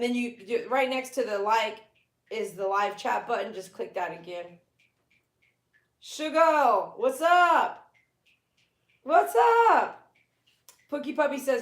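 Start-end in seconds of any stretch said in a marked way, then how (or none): 6.0-10.1 high, loud drawn-out greeting; calling out. Real, not acted. Streamer hailing someone in the room, calling out. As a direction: holler it, pitched right up.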